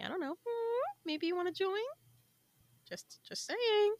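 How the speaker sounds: high, soft sing-song nudge; playful, mock-innocent suggestion; coy hinting. Coy hinting about joining Discord.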